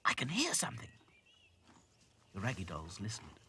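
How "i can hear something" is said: high, urgent whisper; hissed whisper. A narrator's reading. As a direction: whisper it as a warning. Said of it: "hissed Dotty".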